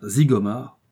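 plain reading voice

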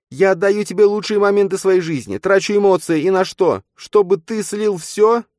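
high, loud bitter reproach; furious berating. Acted. Nastya berating Matvey.

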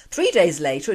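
ordinary delivery

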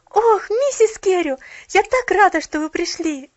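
high, loud glad greeting; joyful cry. A narrator's reading. Girl greeting Mrs Carew, joyful. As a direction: greet her with joy. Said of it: "с радостным возгласом".

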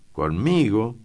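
high, loud startled question; exclaimed. A narrator's reading. Eduardo hears he is to come along, exclaiming in surprise. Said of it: "exclamó Eduardo".

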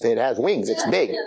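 ordinary talk

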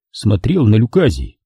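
ordinary delivery

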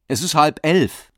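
high, loud protest; calling out. A narrator's reading. Leo on the phone, protesting the late call loudly. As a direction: raise the voice in annoyed protest. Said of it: "rief Leo".

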